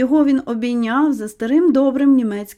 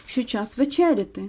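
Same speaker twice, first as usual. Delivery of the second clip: ordinary delivery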